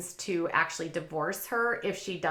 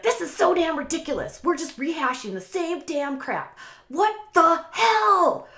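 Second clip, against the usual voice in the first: imitating the husband's outburst, much higher and louder; Neanderthal rant; 10 semitones higher, 6 dB louder than usual. Husband blowing up at his wife over the house, in a mock furious rant. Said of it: "he went, like, Neanderthal there".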